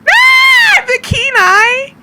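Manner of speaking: high, loud scream into drawn-out words; excited shriek. Real, not acted. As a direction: shriek it, then stretch it out. Shrieks at a game moment.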